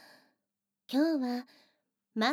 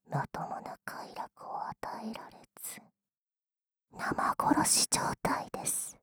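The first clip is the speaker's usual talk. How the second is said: whispering; 3 semitones lower than usual. Acted. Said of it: "耳元でささやかれても".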